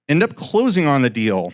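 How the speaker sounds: usual speaking voice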